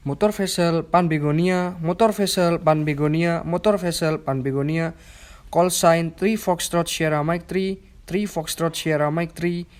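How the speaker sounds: over a radio. Shown as a demonstration. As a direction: answer the call clearly over radio. Radio call demo video.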